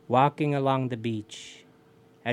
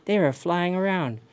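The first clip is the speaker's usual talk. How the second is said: plain reading voice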